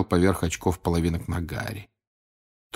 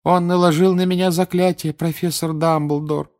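put-on high, loud girl's voice; pitched-up schoolgirl tattling; complaining teenage girl; 9 semitones higher, 4 dB louder than usual. A narrator's reading. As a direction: tell on someone to the professor, high and loud. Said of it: "вдруг заговорила девушка".

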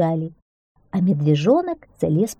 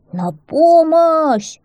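high, loud, slow drawn-out cry; shouting for help; 11 semitones higher, 8 dB louder, much slower than usual. A narrator's reading. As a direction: call desperately for rescue, stretching the vowel. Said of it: "закричал медвежонок".

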